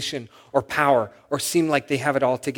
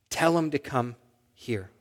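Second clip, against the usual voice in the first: soft, measured retold command; quiet instruction; 9 dB quieter than usual. Jesus stops and speaks to those near him, quiet instruction. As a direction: say it softly and unhurriedly.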